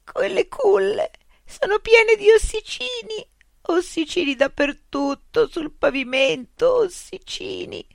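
put-on girl in tears telling what she saw, hysterical; hysterical stammering. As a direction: blurt it out in tearful hysteria. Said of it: "balbettò istericamente".

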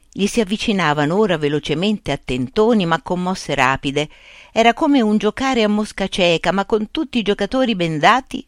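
usual speaking voice

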